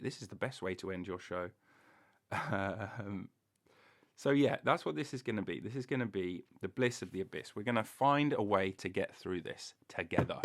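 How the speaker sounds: ordinary talk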